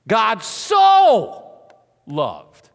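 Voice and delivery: "so" held long and loud, then fall; preacher's drawn-out "so"; sustained booming "so"; stress on so. Shown as a demonstration. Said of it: "the word so kinda sets it up".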